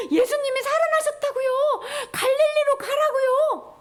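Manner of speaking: high, loud pleading to be believed; insistent outcry. Retold or imitated. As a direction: insist urgently to people who doubt you. Mary insists to the doubting disciples.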